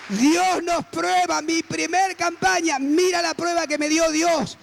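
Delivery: slow, high-pitched proclamation; triumphant preaching. Real, not acted. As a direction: stretch each word out to the whole congregation in triumph. Preaching climax after the story.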